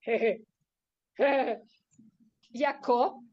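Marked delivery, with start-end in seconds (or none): 0.0-1.6 tiny restrained "hehe"; dignified little acted titter; mini giggle. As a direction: let out a small, contained chuckle. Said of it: "él soltaba un mini jeje".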